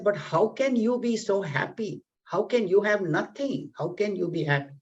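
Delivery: begging to understand his joy; pleading king. Acted. King pleading with the ascetic, amazed and high.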